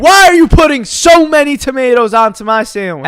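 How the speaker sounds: hammed-up annoyed customer; mock angry customer. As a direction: act out the outraged customer's complaint.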